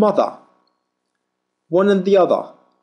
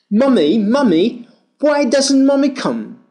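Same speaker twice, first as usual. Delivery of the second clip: calling for mummy; 7 semitones higher than usual. Acted. Child calling for mother.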